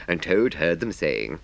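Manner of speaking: ordinary delivery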